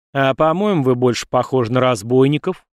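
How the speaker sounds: usual speaking voice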